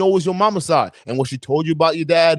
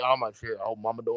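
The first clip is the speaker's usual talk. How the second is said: hushed, much softer, dreading mom's return; quiet dread; 7 semitones lower, 11 dB quieter than usual. Retold or imitated. Son dreading mom coming home, hushed dread. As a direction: murmur it like a scared kid. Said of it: "I used to dread".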